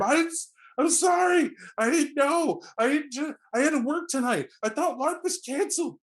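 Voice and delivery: high, frantic begging; panicked pleading. Acted. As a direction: beg for mercy in a high panicked voice. GM voices panicked victim.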